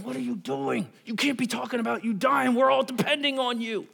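imitated pleading protest, pitch climbing high; Peter. Disciple objects to his teacher talking of dying, high pleading protest. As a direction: protest in a high, urgent plea. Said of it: "you can imagine Peter's going".

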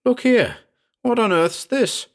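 startled exclamation. A narrator's reading. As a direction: blurt it out in astonishment. Startled on finding blood on arm.

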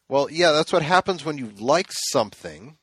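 smartass. Retold or imitated. Host retelling his comeback to the kid, smartass. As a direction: needle him like a smartass. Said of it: "I was kind of being a smartass".

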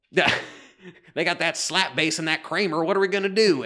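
mock-worried Texan executive; Southern accent. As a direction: fret in a put-on Southern accent. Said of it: "now we have no more Southern listeners".

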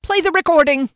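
high, loud, abrupt order; bold demand. A narrator's reading. Friend defying his boss, bold demand. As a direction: fire off the order in one breath. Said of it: "Taken aback at this effrontery".

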